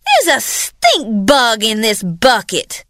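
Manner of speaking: spiteful sister. Acted. Ruby Rouge mocking Blanchette's bucket, a high spiteful jab. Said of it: "and Ruby Rouge said".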